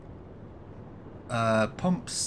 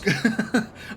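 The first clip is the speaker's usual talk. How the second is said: high giggle breaking into the sentence; sudden high-pitched laugh; burst of laughter; 8 semitones higher than usual. Real, not acted. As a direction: laugh it off mid-thought.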